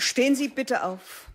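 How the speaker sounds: high-pitched classroom order; teacher's command. A narrator's reading. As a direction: call the class to its feet, measured.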